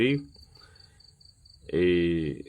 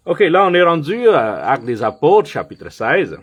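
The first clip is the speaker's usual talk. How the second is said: higher and louder scripture reading from the pulpit; preaching; 4 semitones higher, 5 dB louder than usual. Acted. Pastor reading Acts sixteen aloud to his church, preaching voice. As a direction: proclaim the verses to the church.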